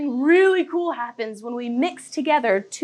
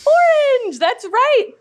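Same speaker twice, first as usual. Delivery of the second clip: much higher, louder orange; excited shout; 11 semitones higher, 6 dB louder, slower than usual. Acted. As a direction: belt out orange. Exaggerated orange.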